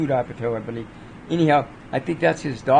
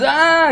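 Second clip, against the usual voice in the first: sudden high yelp, much louder; mock pained cry; 13 semitones higher, 6 dB louder than usual. Kid yelps as the tattoo needle hits, mock cry. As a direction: yelp like a kid getting inked. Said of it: "He starts crying".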